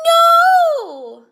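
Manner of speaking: very high, very loud scream of no; anguished cry. Acted. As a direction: cry out in despair. Cat runs out the door.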